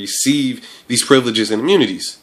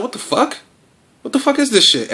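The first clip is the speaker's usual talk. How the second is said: shocked disbelief; 14 semitones higher than usual. Retold or imitated. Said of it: "And we're just like".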